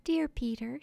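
high-pitched, lightly voiced wife; tender wife. A narrator's reading. Wife with hand on husband's shoulder, tender.

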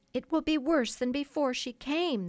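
put-on lost boys crying out in alarm. A narrator's reading. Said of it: "they cried".

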